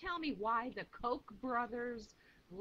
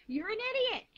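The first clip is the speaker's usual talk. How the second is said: angry outburst; 10 semitones higher, 4 dB louder, much faster than usual. Real, not acted. Ranting insult at opponents.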